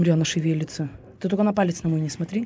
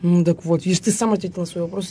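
usual speaking voice

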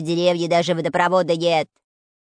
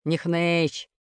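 barked; 3 semitones lower than usual. A narrator's reading. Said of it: "рявкнул".

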